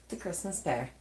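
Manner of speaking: usual speaking voice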